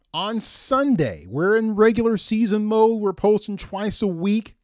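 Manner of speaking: usual speaking voice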